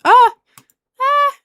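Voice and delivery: sharp high yelp; real startled ah; startled cry. Real, not acted. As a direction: jump at it with a short cry. Sudden surprise in game.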